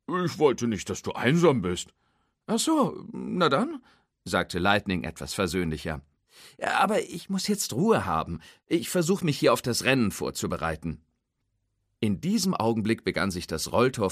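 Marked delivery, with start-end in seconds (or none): 0.1-1.9 lightly voiced tow truck. Hook's voice. 2.5-11.0 lightly voiced race car.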